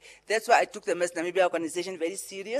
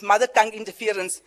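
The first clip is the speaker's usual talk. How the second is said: normal talking voice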